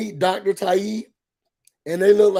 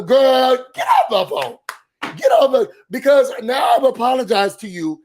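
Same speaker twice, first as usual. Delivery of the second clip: loud, higher mock exasperation; exasperated outburst; 4 semitones higher, 4 dB louder than usual. Telling her to get off the phone, exasperated. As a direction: burst out, fed up with the caller.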